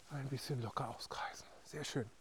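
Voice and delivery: soft whispered cue; whispering. Real, not acted. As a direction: whisper it gently. Cooldown cue after effort.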